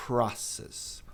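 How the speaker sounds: slow "Process", airy P and open A; PRA-cess with puff of air after P; correct pronunciation. Shown as a demonstration. Teacher repeats for learners, careful aspirated "Process". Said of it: "Process e não process".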